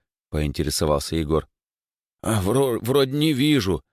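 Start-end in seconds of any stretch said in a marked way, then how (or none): 2.2-3.8 high, strained, halting reply; puffing. Acted. Boy lifts a heavy floorboard, puffing. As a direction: puff it out while heaving something up. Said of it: "пропыхтел Димыч".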